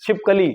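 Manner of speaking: छिपकली with a short a in कली; teacher modelling छिपकली; correct form. Shown as a demonstration. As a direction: say छिपकली as chhip-ka-li. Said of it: "कली होती है".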